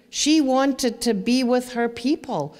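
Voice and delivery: normal talking voice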